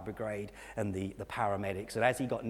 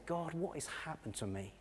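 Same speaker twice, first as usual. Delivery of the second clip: retold sob; 5 semitones higher, 9 dB quieter than usual. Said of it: "began to cry and sob and say".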